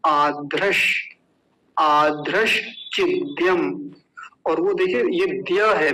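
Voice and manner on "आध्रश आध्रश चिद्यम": आध्रश चिद्यम slowly, with long आ and breathy aspirated ध; model pronunciation. Shown as a demonstration. Teacher showing students how the joined word sounds, आध्रश चिद्यम drawn out slowly. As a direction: draw out आध्रश चिद्यम syllable by syllable. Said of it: "तो क्या बन जाएगा?".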